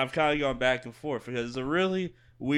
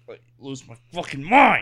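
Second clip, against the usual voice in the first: frustrated outburst; 3 semitones higher, 10 dB louder than usual. Real, not acted. About to sneeze, frustrated.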